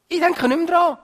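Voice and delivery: very high, insistent repeat; emphatic assurance. Acted. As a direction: cry it out high as God's promise. God's words repeated far more emphatically. (eindringlich hoch ausgerufene Zusage)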